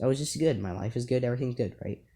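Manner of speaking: ordinary talk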